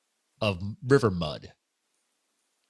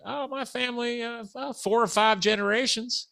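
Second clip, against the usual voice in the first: put-on Southern accent; 11 semitones higher than usual. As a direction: imitate an old Southerner, pitched well up. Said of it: "I can't do a good Southern accent".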